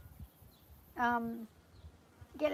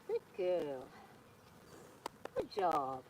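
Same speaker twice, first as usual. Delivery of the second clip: soothing horse praise; 6 semitones lower, 6 dB quieter, much slower than usual. Real, not acted. Praising horse after stepping back.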